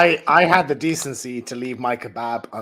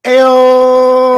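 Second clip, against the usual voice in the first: high, loud, drawn-out "Ayo!"; shouted greeting; 10 semitones higher, 13 dB louder than usual. Real, not acted. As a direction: holler a long hello as you join. Shouted greeting on being introduced.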